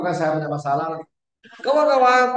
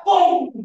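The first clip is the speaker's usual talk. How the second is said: high loud blast of bom!; explosion bom; 10 semitones higher, 7 dB louder than usual. Acted. Preacher miming pent-up anger exploding, shouting.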